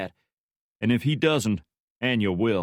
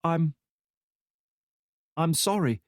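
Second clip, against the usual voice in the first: hesitant apology; 8 semitones higher than usual. Acted. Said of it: "groping for the words".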